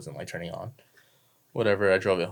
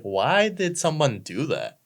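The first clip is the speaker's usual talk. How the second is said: high, drawn-out baffled question; baffled disbelief; 8 semitones higher, 4 dB louder, slower than usual. Retold or imitated. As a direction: stretch it out in high-pitched disbelief. Reacting to a baffling modification.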